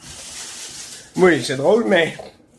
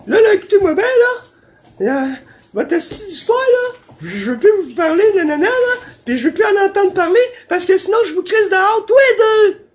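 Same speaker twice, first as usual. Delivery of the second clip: much higher and louder, screechy landlady; shrill angry landlady; 13 semitones higher, 5 dB louder than usual. Retold or imitated. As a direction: scream it like the furious landlady. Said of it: "elle m'a dit". (propriétaire hystérique imitée, très aiguë et forte)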